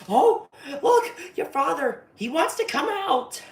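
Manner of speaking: high, loud sing-song clown taunt; shrill mocking clown voice; taunting Pennywise. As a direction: tease her like a gleeful killer clown. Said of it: "Pennywise toys with her, being like".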